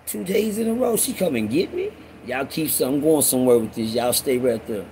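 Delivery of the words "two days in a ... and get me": high, softened voice of disbelief; amazed aside. Acted. Storyteller marvelling at a second visit, amazed. Marvelling at being picked twice.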